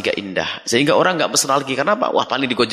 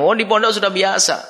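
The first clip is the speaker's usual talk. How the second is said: dismissive students; 7 semitones higher than usual. Retold or imitated.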